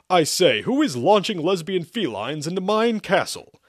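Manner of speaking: high, loud kingly demand; indignant king. A narrator's reading. King demands who attacks his castle, loud indignation. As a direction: declaim like an affronted monarch. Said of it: "King Vitamin asked".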